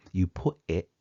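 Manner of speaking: Cockney with softened final T's; Cockney accent. Shown as a demonstration. Lecturer demonstrating the second sentence in dialect, softened Cockney accent. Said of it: "a little bit softer there perhaps".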